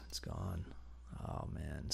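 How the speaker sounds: It's gone breathed out as a whisper; whispered disappointment. Real, not acted. Whispered repeat after plain.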